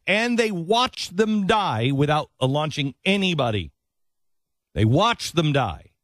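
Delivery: high-pitched, emphatic outrage; indignant accusation. Real, not acted. Host accusing officials over those killed in attack, indignant. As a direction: drive each accusation home, pitched well up.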